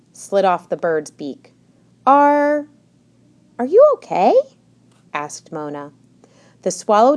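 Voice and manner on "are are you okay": higher, halting, worried question; hesitant concern. A narrator's reading. Mona asks a tearful bird, hesitant and concerned. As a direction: ask timidly, with a pause.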